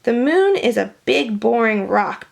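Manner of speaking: ordinary delivery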